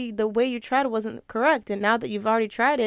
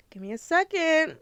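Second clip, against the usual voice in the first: tearful; 6 semitones higher than usual. Real, not acted. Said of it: "I'm a crybaby".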